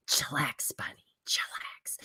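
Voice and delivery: hushed mock whisper. Attorney hushing Smollett, in a mock whisper. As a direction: whisper it like a lawyer calming him. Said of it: "his attorney was like".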